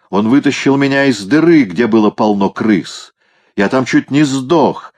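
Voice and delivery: put-on high, emphatic drunk-cat outburst; growling cat. A narrator's reading. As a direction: plead your rescue story with heated drama. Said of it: "прорычал кот". (пьяный кот, с надрывом)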